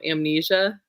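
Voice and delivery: normal talking voice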